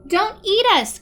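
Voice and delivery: loud, high pleading cry; frightened cry. Acted. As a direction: beg not to be eaten. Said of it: "cried George".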